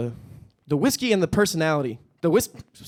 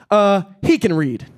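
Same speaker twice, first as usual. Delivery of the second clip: snarky comeback; 5 semitones higher, 7 dB louder, slower than usual. Retold or imitated. Deadpan comeback to stranger.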